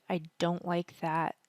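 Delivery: ordinary talk, nothing marked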